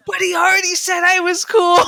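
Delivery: high-pitched, wounded complaint; mock whining protest. Boy exposed in a wig, mock protesting. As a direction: imitate someone caught out and protesting.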